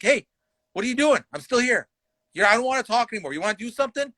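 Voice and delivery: high, pushy dare; aggressive challenge. Retold or imitated. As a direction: push him to fight. Retold threat.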